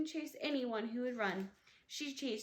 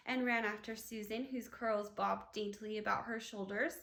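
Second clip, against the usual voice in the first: usual speaking voice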